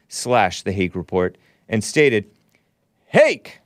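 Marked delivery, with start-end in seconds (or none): 3.1-3.6 high, punchy mock shout; exclaimed name. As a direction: read it out as an excited exclamation. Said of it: "Exclamation mark, exclamation mark".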